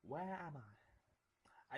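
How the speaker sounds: frightened whisper. Acted. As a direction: breathe it out, scared and lost. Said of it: "I say quickly".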